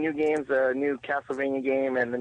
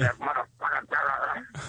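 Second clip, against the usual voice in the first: slow mutter; much slower than usual. Retold or imitated. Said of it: "we'd just be like".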